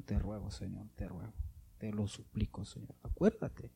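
soft pleading fading into a whisper; hushed prayer. Shown as a demonstration. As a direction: murmur the plea, dropping to a whisper. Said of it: "¿cómo empezó Ezequías su oración?".

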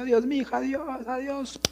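voz aguda y cantada de madre que despide a su hija; madre cariñosa. Retold or imitated. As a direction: imita a la mamá diciendo adiós, más aguda. (mamá en el bus despidiéndose de su hija, voz aguda y dulce)

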